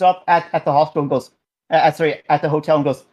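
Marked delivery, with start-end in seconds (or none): none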